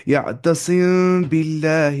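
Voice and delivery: "یعتصم باللہ" drawn out very slowly, the م of یعتصم hidden in a nasal hum before ب; teacher recites "یعتصم باللہ" slowly for students to copy, long nasal hum on the م; recited with ikhfa. Shown as a demonstration. Teacher models the ikhfa in "یعتصم باللہ" for students, slow nasal hum. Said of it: "مگر اخفاء کرنا بہتر ہے".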